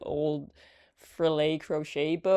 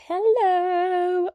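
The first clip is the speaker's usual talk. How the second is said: very high, drawn-out, held hello; sing-song phone greeting; 13 semitones higher, 5 dB louder than usual. Retold or imitated. Answering mother-in-law's phone call, high sing-song greeting. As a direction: chirp a long, loud, high-pitched greeting.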